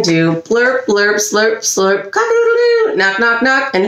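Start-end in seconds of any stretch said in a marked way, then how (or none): 2.1-2.9 high stretched-out rooster call; rooster crow. Pretend. Rooster recap.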